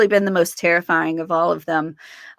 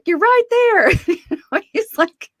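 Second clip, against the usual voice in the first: much higher, laughing through it; 13 semitones higher, 4 dB louder than usual. Real, not acted. Telling her fiance he's right there, laughing. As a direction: giggle while pointing it out.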